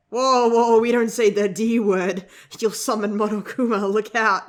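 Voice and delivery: loud, playful warning; mock alarm. Reader jokingly warning against summoning Monokuma, loud mock alarm. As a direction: cry out in pretend alarm.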